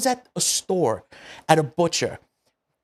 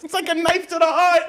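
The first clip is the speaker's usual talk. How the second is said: high laughing cry; pained laughing protest. Real, not acted. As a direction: laugh through the words, much higher. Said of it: "I say mozzarella".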